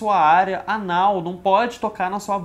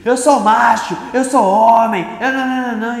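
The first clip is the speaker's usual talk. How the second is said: loud, higher indignant protest; mock macho quarrel; 5 semitones higher, 8 dB louder than usual. Vendors who hugged now bickering to prove they're macho, mock shouting. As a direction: shout it like men defending their manhood. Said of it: "começaram a meio que brigar".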